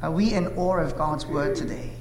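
ordinary talk

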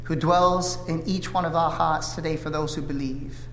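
ordinary talk, nothing marked

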